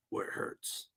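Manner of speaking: whispered, mock-sinister; playful threatening whisper; mock menacing whisper. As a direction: hush it like a cartoon villain. Joking threat to viewers.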